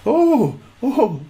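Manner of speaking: very high, loud, laughing mock voice of the girl; imitating the date. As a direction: squeal it like the delighted date. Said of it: "she, she goes".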